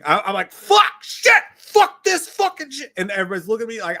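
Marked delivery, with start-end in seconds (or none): 0.5-2.9 high-pitched, shouted string of curses; cussing outburst. Retold or imitated. Rider retelling the coaster's hard turns, shouted cussing. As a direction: yell out drawn-out, panicked-sounding swearing. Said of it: "And I start cussing".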